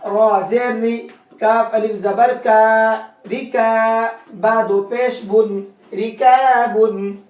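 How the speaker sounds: slow, drawn-out spelling to "रिकाबुन"; light R, long "kaa" in "रिकाबुन"; thin Ra. Shown as a demonstration. Teacher practising with students, thin Ra recitation. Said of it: "इस रा को बारीक करके पढ़ेंगे".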